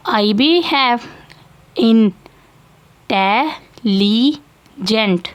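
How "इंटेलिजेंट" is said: slow syllable demo. Shown as a demonstration. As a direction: stretch intelligent out very slowly, syllable by syllable. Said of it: "तो आई की sound भी निकली है".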